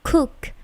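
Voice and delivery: "Cook" with a puff of air on the first K; airy K burst in "Cook"; plosive K. Shown as a demonstration. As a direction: say "Cook" with an aspirated start. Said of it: "C-nya itu plosif".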